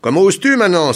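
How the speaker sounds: loud, high indignant outburst; indignant cry. A narrator's reading. Governor crying out at a passer-by who dared not greet him, indignant. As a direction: cry out in outrage. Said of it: "s'écria Gong Jing".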